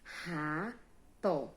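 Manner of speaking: "rato" in two beats, ra... to; teacher's slow syllable demo of "rato"; split into syllables. Shown as a demonstration. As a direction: pause between ra and to in "rato". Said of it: "eu só abro a boca duas vezes".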